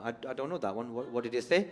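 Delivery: usual speaking voice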